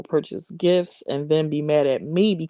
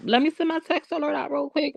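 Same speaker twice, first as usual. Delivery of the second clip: put-on hush baby voice; 8 semitones higher than usual. Shown as a demonstration. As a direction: coo it like hushing a baby. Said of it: "hush, hush baby voice on".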